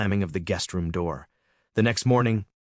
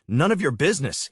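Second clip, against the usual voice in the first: higher, contemptuous sneer; 6 semitones higher, 3 dB louder than usual. Acted. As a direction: sneer it at your stepfather. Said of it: "he sneered".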